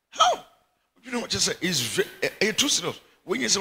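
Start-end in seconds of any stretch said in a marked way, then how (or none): 0.1-0.5 a shocked, much higher and louder How; astonished high-pitched question; incredulous how. Real, not acted. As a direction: fire it back in disbelief. Preacher reacts in disbelief.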